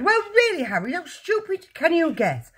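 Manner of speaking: very high, exasperated scolding; crying out. A narrator's reading. Mother calls Harry stupid, crying out. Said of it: "the mother cried".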